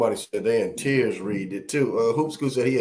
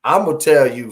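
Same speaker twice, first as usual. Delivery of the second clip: ordinary talk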